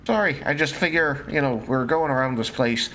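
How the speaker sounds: lightly voiced game character; in-character adventurer. Adventurer explains to the party why they should gather, lightly in character.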